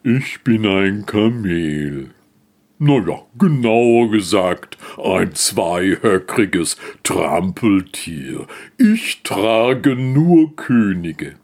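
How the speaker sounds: langsam und gedehnt, hochmütig; stolzes Kamel. Acted. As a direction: jedes Wort langsam und würdevoll setzen. Kamel stellt sich vor. (Kamel stellt sich dem Esel stolz und langsam vor)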